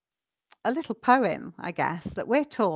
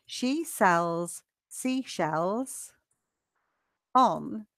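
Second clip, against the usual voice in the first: very slow, broken into single words and pairs; very slow modelling; much slower than usual. Shown as a demonstration. Teacher helps with a quite difficult one, very slowly. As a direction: stretch it out with long gaps for learners.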